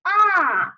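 high-starting 啊 that falls; fourth tone. Shown as a demonstration. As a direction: drop 啊 downhill. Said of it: "读四声啊".

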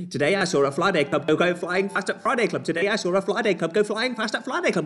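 fast and high gabbled twister; fast as possible. Shown as a demonstration. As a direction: gabble it at top speed. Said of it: "How fast can you do it".

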